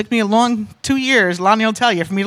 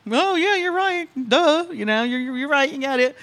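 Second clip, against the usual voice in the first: higher-voiced friend giving in; imitated agreeing friend; 6 semitones higher than usual. Friend conceding the point over dinner, imitated high-voiced agreement. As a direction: put on a high voice of sudden agreement.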